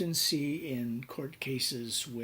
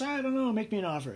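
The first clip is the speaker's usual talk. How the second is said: much higher, louder offhand seller; careless seller; 10 semitones higher, 5 dB louder, faster than usual. Retold or imitated. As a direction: shrug it off to the buyer. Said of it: "And the guy goes".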